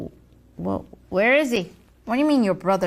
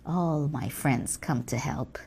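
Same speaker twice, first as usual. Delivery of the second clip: normal talking voice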